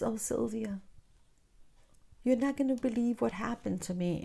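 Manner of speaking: a hint of a doting mother; adoring mom. Imitating her mom's adoring sigh. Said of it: "an adoring voice".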